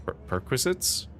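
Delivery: a baffled repeat that climbs into a question; puzzled echo. Acted. Listener puzzled by the envoy's odd word, rising in disbelief.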